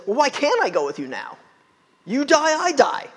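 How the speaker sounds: rising loud and high to "I die"; sanctimonious Peter. Retold or imitated. Peter protesting to Jesus, loud, high and indignant. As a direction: insist like a brash disciple. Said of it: "in true Peter fashion".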